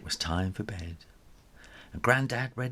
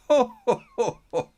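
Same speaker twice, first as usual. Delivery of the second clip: high, loud chuckle; hearty laugh; 14 semitones higher, 6 dB louder than usual. Acted. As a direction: laugh it off kindly. Grandad laughs at the joke.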